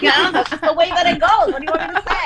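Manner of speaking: amused, hurried comeback; laughing retort. Retold or imitated. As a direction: defend yourself while laughing. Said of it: "It's like".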